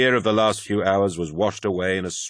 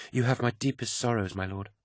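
a hint of a softer, gentle woman's voice; tender condolence, quieter than narration; softly voiced young woman; 4 semitones higher, 6 dB quieter than usual. A narrator's reading. Petronella consoles the War Master.

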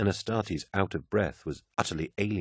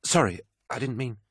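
flustered, higher and hurried; 5 semitones higher than usual. Acted. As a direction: caught off guard, stammering apology. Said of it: "Momentarily flustered".

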